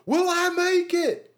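high, slow shouted question; shouting. A narrator's reading. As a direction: shout it over the noise. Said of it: "you shout".

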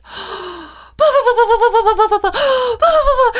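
high, loud, rapid gushing chatter; imitating her daughter pouring it all out, high and loud. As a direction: mimic a kid spilling everything at once, laughing. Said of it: "And she goes".

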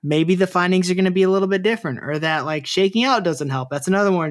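ordinary delivery

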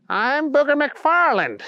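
high, drawn-out announcement; proud dim Booger. A narrator's reading. Booger answering the bunny's insult, high and oblivious. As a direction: pitch it up and stretch the name.

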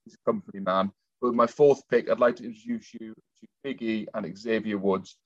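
ordinary delivery

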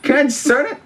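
put-on high old-timer echo; old prospector. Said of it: "quit talking like an eighteenth century prospector".

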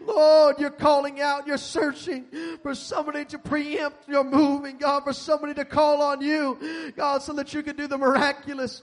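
loud, high pleading prayer; fervent prayer. Real, not acted. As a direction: cry out to God with urgency.

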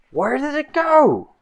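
high, slow, panicked merchant; high alarmed, where did it go; panicked confusion. Acted. Merchant panics at missing goods.

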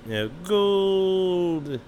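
very slow, drawn-out "gold" like Goldmember; a mock Austin Powers Goldmember drawl; Goldmember impression. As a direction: stretch "gold" out lazily in a put-on villain voice. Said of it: "It's like the Austin Powers Gold member".

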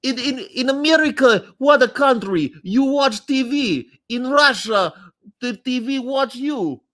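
exaggerated Russian stand-up accent, way up high; Yakov Smirnoff impression. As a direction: boom out a cartoon Russian comic's joke in a heavy Russian accent. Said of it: "It's trying my Yakov Smirnoff".

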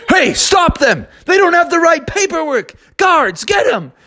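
high, frantic guard shouting orders; guard crying out to his men; guard yelling. Acted. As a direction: squeal it out in alarm. Guard shouts after fleeing car.

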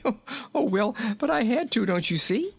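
high squealing, giggling king; merry, high-pitched hilarity; jolly laughter. A narrator's reading. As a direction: break up with high laughter mid-sentence. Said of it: "the jolly sounds of his laughter".

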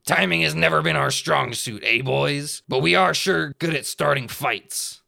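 rally the crew like a pirate. Acted. Pirate speaking.

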